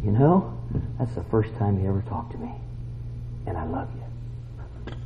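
soft, low, tender little voice. Retold or imitated. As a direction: let it fade almost to a whisper. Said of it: "a little voice come to me".

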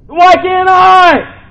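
loud, high, very slow cry; anguished cry. Retold or imitated. Imagined plea about forgetting sins.

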